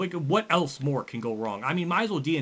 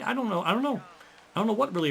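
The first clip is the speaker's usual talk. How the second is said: exasperated; 10 semitones higher, faster than usual. Real, not acted. Exasperated at race choices.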